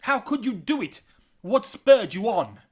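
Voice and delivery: high, loud accusing outburst; angry shouting. A narrator's reading. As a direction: shout the questions in outrage. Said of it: "As Arthur shouted these words".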